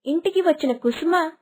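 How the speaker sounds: usual speaking voice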